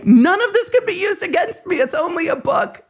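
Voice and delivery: high, sing-song mock of the disclaimer. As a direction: parody it in a squeaky high voice. Mocking the disclaimer.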